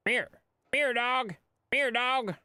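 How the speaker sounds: sing-song call for a pet; high dog-calling voice. Acted.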